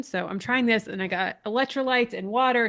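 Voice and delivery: ordinary talk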